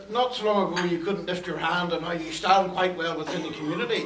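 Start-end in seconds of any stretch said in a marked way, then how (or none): none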